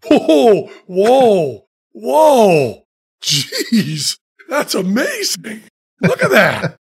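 high, breathless whoa with a laugh; awed amazement. Retold or imitated. Reacting to De Niro's outburst, awed and laughing. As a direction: gape at the acting like a fan. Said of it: "And I'm going".

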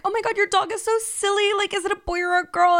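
high, gushing voice of a fan; gushing counter woman. Retold or imitated. Counter woman gushing over the dog, high and delighted. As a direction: coo over the dog like a smitten stranger. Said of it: "The woman behind the counter is like".

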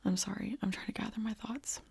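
pulling herself together after crying; crying softly. Real, not acted. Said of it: "I'm straight up crying".

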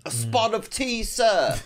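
high, prim posh English voice; posh British accent. Retold or imitated. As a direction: put on a fancy upper-class accent. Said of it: "real fancy".

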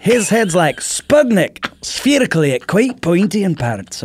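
broad Scottish neighbour rambling; comic Scottish storyteller; Scottish accent. Retold or imitated. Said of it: "your Shrek is spot on Scottish".

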